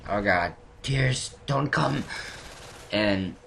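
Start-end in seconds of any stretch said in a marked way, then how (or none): 0.1-2.0 fighting tears. Retold or imitated. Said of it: "And I'm like".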